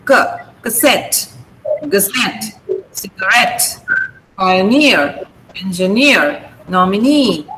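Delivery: "cassette", "gazette", "cigarette", "pioneer", "engineer", "nominee" each stressed on the last syllable; light starts, long stressed endings on "cassette" through "nominee"; short unstressed syllables. Shown as a demonstration. Teacher recapping "cassette" to "nominee" for students, final syllables stressed. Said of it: "it becomes very short".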